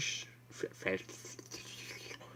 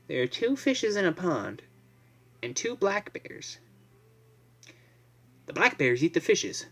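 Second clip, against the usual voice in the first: put-on storybook kid voice; 6 semitones higher than usual. Voicing childlike math story.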